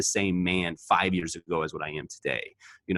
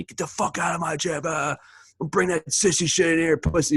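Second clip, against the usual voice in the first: high-pitched mock scream; retold screaming; 10 semitones higher, 3 dB louder than usual. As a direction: imitate a coach cursing someone out. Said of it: "screaming at me".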